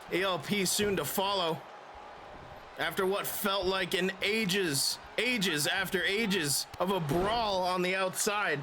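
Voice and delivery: lively play-by-play call; wrestling commentator. Acted. Plays a wrestling game, commenting.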